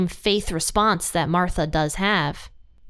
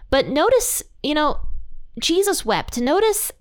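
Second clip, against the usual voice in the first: excited emphasis; 8 semitones higher than usual. Real, not acted. Stressing Jesus wept to viewers.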